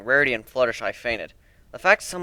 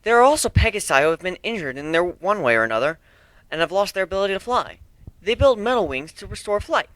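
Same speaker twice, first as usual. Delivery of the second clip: Twilight explaining; 7 semitones higher, 3 dB louder than usual. Acted. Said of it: "Twilight listed off".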